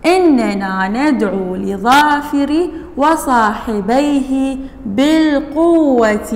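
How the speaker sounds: words drawn out one by one; slow dictation reading. Shown as a demonstration. Teacher dictating a sentence slowly to the class. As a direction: read it slowly for pupils to write. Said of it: "الباء حرف جر".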